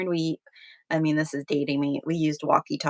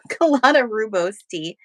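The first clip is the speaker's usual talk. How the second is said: laughing through the words after a slip; 4 semitones higher, 6 dB louder than usual. Real, not acted. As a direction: giggle out the rest of the name.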